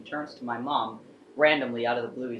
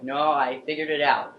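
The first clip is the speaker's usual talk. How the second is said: imitating grandpa's pleased insistence, louder; lightly triumphant, brushing off the apology; retold happy insistence; 3 semitones higher, 6 dB louder than usual. Grandpa waves off mom's apology, retold with happy triumph. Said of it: "never seen him so happy before".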